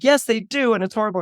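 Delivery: wounded schoolboy protest; whiny complaint. Acted. Boy complains to his mother.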